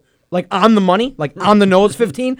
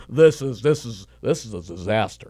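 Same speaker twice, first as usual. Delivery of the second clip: mock disgust; 10 semitones lower, 6 dB quieter than usual. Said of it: "And you're like".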